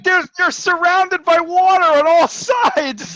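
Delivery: high, loud, slow exasperation; exasperated retort. Retold or imitated. Reacting to Spider-Man webbing the ferry, high exasperated retort. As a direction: spell out the obvious, fed up. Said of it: "I'm like".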